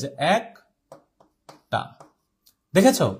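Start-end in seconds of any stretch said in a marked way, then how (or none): 0.4-1.8 একটা said slowly as "æk... ta"; open AE pronunciation. Shown as a demonstration. Teacher showing how the written vowel changes, open AE in একটা. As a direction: split একটা, first vowel open as in "cat". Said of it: "এ এর মতো হয়ে যায়".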